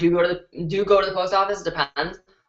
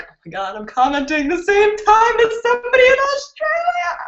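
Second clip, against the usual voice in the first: imitating excited viewer; 13 semitones higher than usual. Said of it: "are excited about, like".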